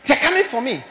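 high, loud, fearful cry; dying man's terror. Retold or imitated. Preacher voicing the dying man, loud and afraid.

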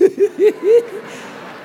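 short laugh. Real, not acted. Laughs mid-reading.

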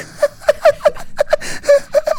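high mock cackle breaking in; sudden very high repeated giggle; imitated laughter. Laughing intrudes on his calm, high cackle. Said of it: "Stop it!".

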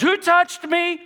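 high, loud yelled question; yelling. Acted. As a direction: yell it over the crowd. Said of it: "And Jesus yells".

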